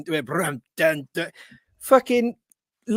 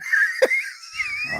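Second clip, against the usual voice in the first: a sudden squealing laugh way up high; high shriek of laughter. Real, not acted. Cracking up at the chicken story, shrieking laugh.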